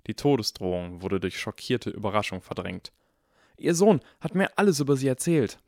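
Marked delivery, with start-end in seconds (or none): 3.6-5.6 high, loud gushing chatter; babbling on. A narrator's reading. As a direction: prattle eagerly at an idol. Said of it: "plapperte Harry weiter".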